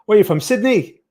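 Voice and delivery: high-pitched mock Australian accent. Streamer asking a viewer where they're from, put-on Australian accent. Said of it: "That's my Australian accent".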